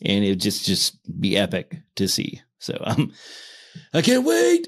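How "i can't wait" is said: excited squeal. Real, not acted. Excited about seeing Messi.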